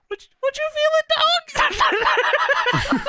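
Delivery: absurdly high squeaky mock outrage; Booker, enraged. Imitating an enraged wrestler answering his rival, squeaky mock outrage. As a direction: squeal it in a falsetto caricature.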